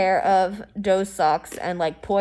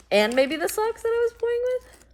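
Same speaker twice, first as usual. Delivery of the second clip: high squeaky voice; 13 semitones higher, 3 dB quieter than usual. Pretend. Pitching the scene to a friend.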